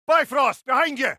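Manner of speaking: loud, high urgent call to a comrade; warning shout. Acted. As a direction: yell the warning over the fight.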